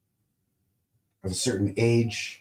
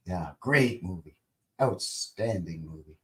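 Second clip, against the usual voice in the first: normal talking voice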